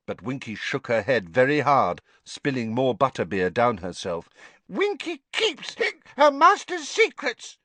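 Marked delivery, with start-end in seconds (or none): 4.7-7.6 high, defiant house elf; stubborn, sulky little elf; mutinous elf voice. A narrator's reading. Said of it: "she said mutinously".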